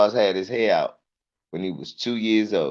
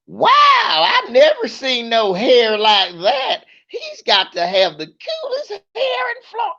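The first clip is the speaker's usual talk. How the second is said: gushing lady. Retold or imitated. Comic mimicking a lady gushing over a boy's hair. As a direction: coo like an over-excited stranger.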